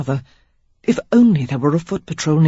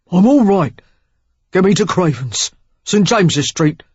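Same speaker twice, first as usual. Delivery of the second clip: loud Cockney man insisting; a wounded Cockney rough, pushing through pain; gruff Cockney; 2 semitones higher, 5 dB louder than usual. A narrator's reading. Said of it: "with a Cockney twang".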